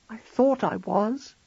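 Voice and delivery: high, slow words held back against tears; a wife fighting her tears. Acted. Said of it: "fighting the tears still".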